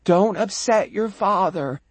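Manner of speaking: put-on mother gently scolding her child. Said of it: "My mother comes into the shop and says".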